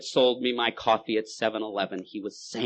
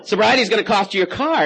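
higher, louder sly God; God the dealer; 10 semitones higher, 8 dB louder than usual. Acted. God raising the price, higher and louder. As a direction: raise the price smugly. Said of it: "And God goes".

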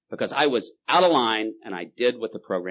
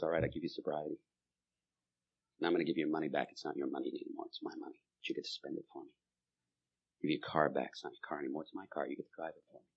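much softer, low, gentle promise; tender father; 5 semitones lower, 6 dB quieter than usual. Acted. God as father gently handing life back, much softer. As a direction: hand it back lovingly. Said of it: "His father looks him in the eye and says".

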